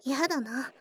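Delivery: ordinary delivery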